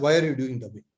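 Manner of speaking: ordinary talk, nothing marked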